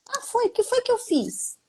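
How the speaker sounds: high mock surprise; imitating a friend caught off guard; surprised reply. Retold dialogue, surprised reply.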